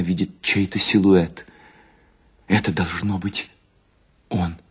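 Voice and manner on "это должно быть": ordinary delivery, nothing marked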